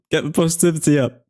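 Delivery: high, fast hype-up; excited. Real, not acted. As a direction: pump the chat up, quickly. Hyping up the chat.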